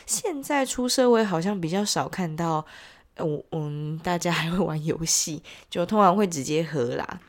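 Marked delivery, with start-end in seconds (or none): none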